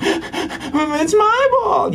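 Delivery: put-on child protesting his turn is over, slow high wail; whiny toddler. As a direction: whine it like a two-year-old who wants the ball. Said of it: "they're going".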